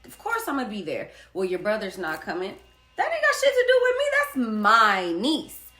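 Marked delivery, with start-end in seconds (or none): 3.0-5.7 loud heated retort. Retold or imitated. Telling sister-in-law off about the niece, loud and indignant. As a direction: fire back loud and indignant, then laugh.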